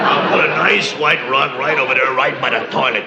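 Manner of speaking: a hint of a plain-talking decorator; blunt decorator. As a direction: toss it off like a regular guy. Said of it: "You very rarely hear an interior decorator say".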